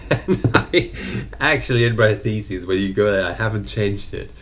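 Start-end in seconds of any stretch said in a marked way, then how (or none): none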